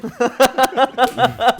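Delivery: loud laughing fit; burst of laughter. Real, not acted. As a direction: crack up laughing. Laughing at the topic. (gros fou rire)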